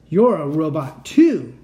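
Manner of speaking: loud, slow and accusing; loud slow accusation. Acted. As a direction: hammer out the accusation slowly.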